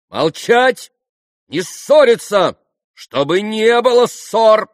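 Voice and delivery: high, loud, slow command; imperious shout. A narrator's reading. Grushenka silencing the quarrelling men, shouting imperiously. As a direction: order them to stop at once. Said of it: "крикнула повелительно Грушенька".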